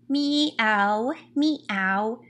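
imitated kitty meowing; kitten meows. Shown as a demonstration. Reader showing what kitties say, imitated meows. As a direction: meow like a little cat, one at a time. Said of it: "That's what kitties say".

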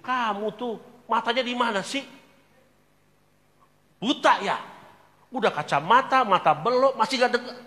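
high, loud mock scolding of the wife; scolding husband. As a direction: snap at her as a cranky husband. Acted husband scolding his wife.

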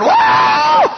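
high, loud drawn-out holler; imitated whoop. Storyteller showing his friend still going, high loud whoop. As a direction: mimic an ecstatic shouted whoop. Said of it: "Hewlin was going".

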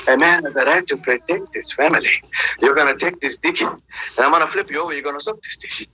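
lightly voiced superhero bragging. Retelling role play with his wife as the hero, low mock voice.

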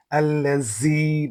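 "আল্লাজি" with a buzzing z for the dh, slow; wrong buzzing z. Shown as a demonstration. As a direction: mispronounce "আল্লাজি" as al-la-zee with long ee. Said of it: "শেষে এটা হয়ে যাবে". ("আল্লাজি"-তে ঝা-এর ভুল ধ্বনি, টেনে)

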